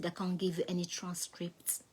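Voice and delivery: soft unvoiced whisper; a lady whispering advice in the court. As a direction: lean in and whisper the tip. Lady's secret advice.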